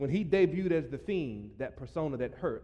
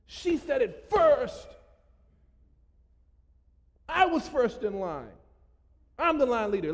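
higher, louder kindergartner voice; childish whine; 7 semitones higher, 5 dB louder than usual. Retold or imitated. Pastor mocking adults acting like kindergartners, childish whine. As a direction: play the tattling kid at the front of the line. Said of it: "We turned into kindergartners".

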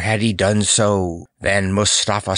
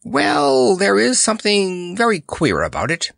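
high-pitched, bemused musing; puzzled admission; 12 semitones higher than usual. A narrator's reading. Clown admits something queer has happened, high and puzzled. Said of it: "in a puzzled way".

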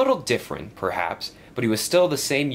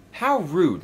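ordinary delivery, nothing marked

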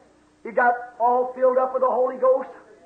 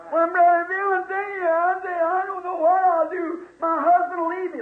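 whiny church member; 5 semitones higher than usual. Acted. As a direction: plead like a worried church lady. Church member complaining to preacher.

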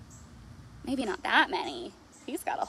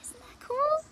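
excited whisper; 14 semitones higher than usual. Acted. Marvelling to the children.

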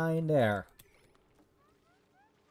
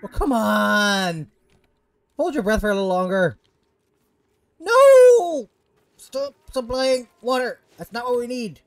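high, loud whining; whiny voice; 5 semitones higher, 9 dB louder than usual. Real, not acted. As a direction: whine like a spoiled brat. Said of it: "the whiny bitch voice".

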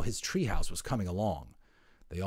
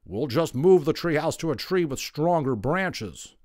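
bristling anger; 6 semitones higher, 6 dB louder than usual. Acted. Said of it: "Mister Otter bristled with anger".

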